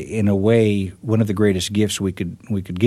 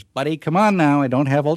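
raised-pitch, exasperated barkeep; mildly fed-up joke bartender, higher voice; impatient bartender; 7 semitones higher than usual. Acted. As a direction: lightly act a bartender running out of patience. Joke's bartender losing patience.

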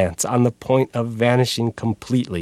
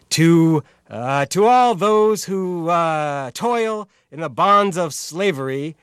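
sharp barking radio tenor, higher; radio announcer voice; 8 semitones higher, slower than usual. Acted. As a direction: declaim it like an old radio announcer. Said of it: "declaiming in a sharp, barking tenor ... announcers on American radio".

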